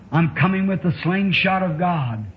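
ordinary delivery

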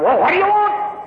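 a grumpy merchant snapping at her; gruff snap. Acted. Crabby shopkeeper snaps at woman.

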